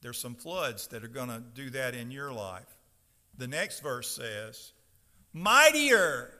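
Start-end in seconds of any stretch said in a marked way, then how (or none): 5.3-6.3 "Mightier" boomed out high and loud; "Mightier" as a big, drawn-out proclamation; emphasis on Mightier. Shown as a demonstration. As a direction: raise the voice hard on "Mightier". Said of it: "put some emphasis on some words".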